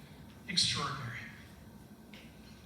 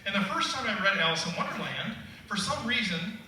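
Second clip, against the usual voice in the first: usual speaking voice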